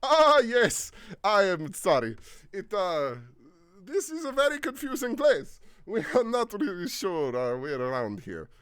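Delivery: high, loud flustered apology; apologetic smile. Acted. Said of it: "seems to smile apologetically".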